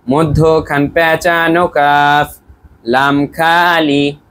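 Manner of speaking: very slow, drawn-out recitation; teacher showing students how to chant the letter rule, very slowly; model recitation. Shown as a demonstration. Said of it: "তাহলে আমরা বলি".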